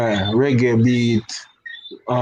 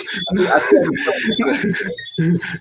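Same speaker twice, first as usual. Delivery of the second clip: laughing; 5 semitones higher than usual. Real, not acted. Guest laughs at R. Kelly joke.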